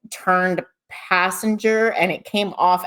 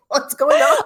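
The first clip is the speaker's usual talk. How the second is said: startled, imitated; 7 semitones higher, 4 dB louder than usual. Imitating Mark startled by the scream.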